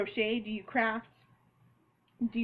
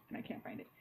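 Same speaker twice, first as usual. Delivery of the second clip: whispered mutter; 10 dB quieter, faster than usual. Real, not acted. Searching for a sticker.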